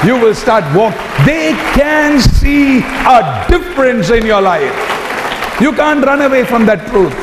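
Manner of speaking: soaring pulpit preaching; preaching at full force. Acted. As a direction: lift the room into a hallelujah.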